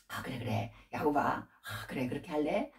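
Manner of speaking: soft, low, easygoing assent; agreeable father-in-law. Retold or imitated. Father-in-law kindly humoring Jacob in the old days, agreeable. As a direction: go along with him gently and quietly. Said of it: "말이 잘 통하고 이랬는데".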